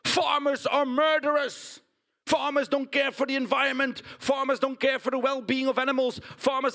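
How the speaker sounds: high, strained protest chant; mock shouting. Critics who never worked on a farm, mock shouting. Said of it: "shouting, you know?".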